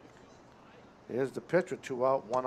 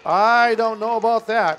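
high, loud doubting reaction; real, excited objection to a close play; loud disbelief; 8 semitones higher, 11 dB louder than usual. Real, not acted. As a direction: protest the call at full volume. Disputing the call on the play.

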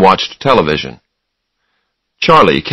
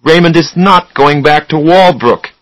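raised, high-pitched insistence; angry; 8 semitones higher, 4 dB louder than usual. A narrator's reading. Charlie refusing to send his brother back, angry. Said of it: "Charlie got angry".

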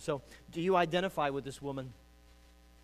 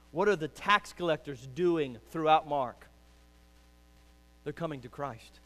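ordinary talk

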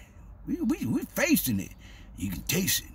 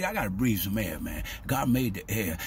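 ordinary talk, nothing marked

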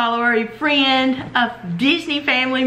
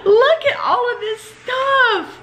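high, laughing, near tears; bursting with happy disbelief; overwhelmed delight; 10 semitones higher than usual. Real, not acted. Overwhelmed opening a gift box.

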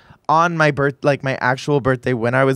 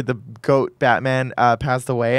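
ordinary talk, nothing marked

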